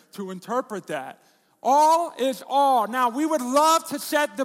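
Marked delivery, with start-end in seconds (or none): 1.6-2.9 slow and loud, leaning hard on each All; emphatic. Real, not acted. Preacher drives home to the church that All means all, slow and loud. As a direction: land every word like a verdict.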